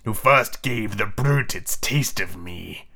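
low, slow villain captain; brooding pirate chief; petulant. Acted. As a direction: demand it sulkily, drawn out. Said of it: "Hook barked petulantly".

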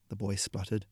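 ordinary delivery, nothing marked

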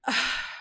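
real breathy sigh of upset; troubled sigh. Real, not acted. Storyteller pausing over what still bothers her, troubled sigh. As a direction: let out a heavy, bothered breath. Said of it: "this still bothers me so much".

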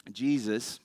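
normal talking voice

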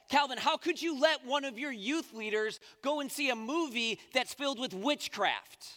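upset church mom. Retold or imitated. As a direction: play the scandalised mom scolding the pastor. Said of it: "the woman was so upset".

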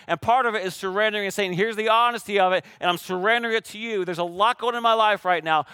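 sermon voice rising to the congregation; preaching at full force. Acted. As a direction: drive the message home. Preaching builds.

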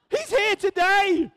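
high, drawn-out exultant cry; wholehearted scream. Real, not acted. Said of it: "let me just scream with all my heart".

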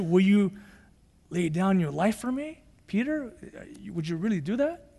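soft, gentle probing of Peter; gentle question. Retold or imitated. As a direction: ease into the topic softly, almost hushed. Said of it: "Kind of gentle".

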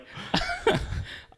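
breathy, voiceless high wheeze; silent wheeze laugh. Real, not acted. Wheezing at how the raid ended, laughing silently. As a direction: laugh with no voice left.

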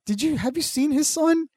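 high panicked mock. Narrator panicking to dad.

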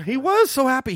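high mock-pitying voice. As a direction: coo it like he's a sad little kid. Mocking Steiner's happiness.

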